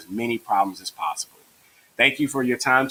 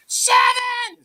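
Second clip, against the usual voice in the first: very high mock class shout; high-pitched imitation of kids shouting; yelled out; 3 dB louder than usual. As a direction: yell the answer like excited pupils. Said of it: "And they yell out".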